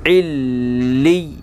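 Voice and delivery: इल्ली slow, with a long held doubled l; firm tashdeed. Shown as a demonstration. Teacher spelling out for pupils, इल्ली with firm doubled l. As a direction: press and hold the l in इल्ली. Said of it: "तजदीद में सख्ती होती है".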